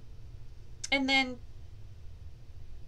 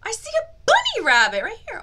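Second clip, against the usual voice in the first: much higher, louder and fast; excited discovery; 12 semitones higher, 12 dB louder, faster than usual. Acted. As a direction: announce the bunny with delight.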